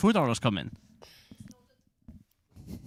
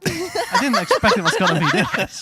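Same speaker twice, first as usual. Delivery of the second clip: laughing in disbelief, slowly; 9 semitones higher, 6 dB louder than usual. Real, not acted. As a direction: laugh it out incredulously. Laughing at the situation.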